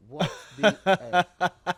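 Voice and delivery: loud shocked "What the F?"; shocked shout. Retold or imitated. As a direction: blurt it out loud, stunned. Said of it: "He was like".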